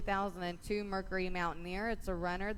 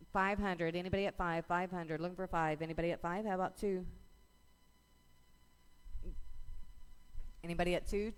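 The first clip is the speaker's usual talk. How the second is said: rapid rhythmic bid calling; auctioneer; faster than usual. Real, not acted. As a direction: auction chant, numbers rolling. Auction chant calling bids.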